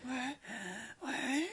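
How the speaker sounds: put-on high, thin little girl's voice; a frail child straining to hear; sick little girl.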